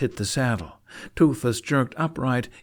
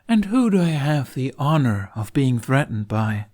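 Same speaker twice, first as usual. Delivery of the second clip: louder, higher mock politeness; sarcastic; 4 semitones higher, 6 dB louder than usual. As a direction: drip with sarcasm at the knight. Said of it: "mustered every bit of sarcasm".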